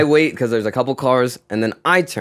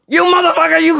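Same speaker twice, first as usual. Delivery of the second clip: much higher, louder furious swearing; cussing him out; 4 dB louder, faster than usual. Retold or imitated. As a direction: rage at the driver, spitting the curse. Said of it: "Cussing him out".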